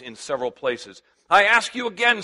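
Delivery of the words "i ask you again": high, loud furious scream. A narrator's reading. Drill officer screaming at the young recruit. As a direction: scream it at the boy. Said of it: "screamed Polynikes".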